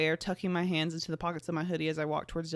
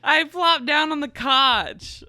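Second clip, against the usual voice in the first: higher, louder, slow laughing reread; laughing through the line; 8 semitones higher, 9 dB louder, slower than usual. Real, not acted. Reader rereading the line while still laughing. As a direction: fight through giggles.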